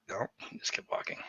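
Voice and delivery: unvoiced whispered aside. Real, not acted. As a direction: whisper it to yourself.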